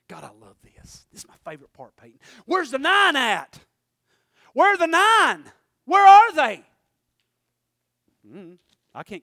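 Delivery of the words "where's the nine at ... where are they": shouted, much higher and louder, slow; loud demanding cry. Acted. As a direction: call out for the missing nine. Jesus demanding the missing nine.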